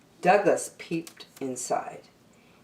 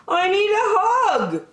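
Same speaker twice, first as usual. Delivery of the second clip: put-on high childlike plea; a small bear begging for a hug; high wistful bear voice; pleading Douglas; 4 dB louder than usual.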